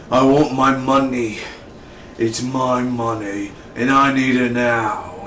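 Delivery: slow, drawn-out demand in a put-on voice; mobster demanding money. [name] demanding his money back, mock menace. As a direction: stretch each phrase like a mock mob boss.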